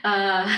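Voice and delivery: breaking into laughs; real laughter at her own slip; laughing at herself. Real, not acted.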